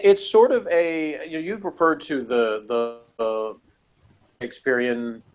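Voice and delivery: ordinary talk, nothing marked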